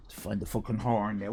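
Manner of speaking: ordinary talk, nothing marked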